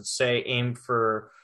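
usual speaking voice

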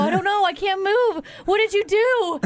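high, wailing mock distress; fake crying. Wife pranking husband that she can't move, fake tearful wail. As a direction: wail it as if badly hurt, hamming it up. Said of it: "so it's fake crying".

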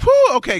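high shout of relief; squealed whew of relief; loud relieved whew. Retold or imitated. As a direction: blow out a big loud whew. Relief after interception.

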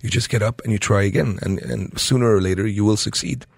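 ordinary delivery

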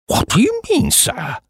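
put-on very high-pitched outraged old boy; indignant cry. A narrator's reading. Old Duke challenged on his identity, indignant. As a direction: start high in offended disbelief, drop on sir. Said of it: "cried the old boy, getting purple".

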